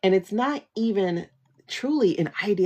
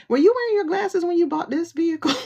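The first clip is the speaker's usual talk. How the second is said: high, incredulous teasing ask; mocking question to Musk; 10 semitones higher, 3 dB louder, faster than usual. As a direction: needle him about his eyesight. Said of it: "You need to let him know, like".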